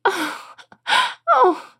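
high little sobbing "oh"s; mock weeping. A narrator's reading. Princess weeps for her lost ball, mock weeping. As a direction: weep in small, high moans. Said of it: "The princess began to weep".

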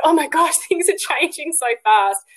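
high-pitched, astonished doula impression; amazed exclamation in someone else's voice; Helena. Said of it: "in her voice, I can't do her accent".

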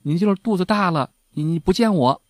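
rapid, indignant yelling at the door; young son shouting that he knows her secret; angry scolding. Retold or imitated. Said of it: "就在门外大骂说".

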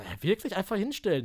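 voiced, puzzled double-check; acting out a baffled question to the instructor; incredulous question. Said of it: "Hab ich zu ihm gesagt".

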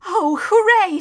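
high, loud cheer; overjoyed boy. A narrator's reading. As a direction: burst out in delight. Boy cheers the escape plan.

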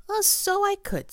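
a tiger struck by a bright idea; delighted tiger. Acted.

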